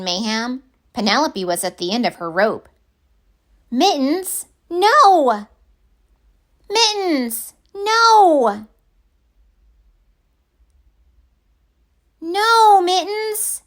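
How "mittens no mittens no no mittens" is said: high, loud scolding no; child yelling. Acted. Penelope yelling at Mittens as he rips things, loud high. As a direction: shout no at the pet, building.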